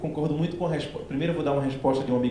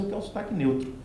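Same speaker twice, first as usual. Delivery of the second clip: mock puzzled question; 7 semitones higher, 5 dB quieter, faster than usual. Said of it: "eu me pergunto sempre assim".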